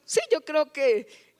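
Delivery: sheepish greedy husband. Retold or imitated. El esposo pide más comida.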